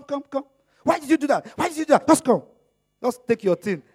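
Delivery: normal talking voice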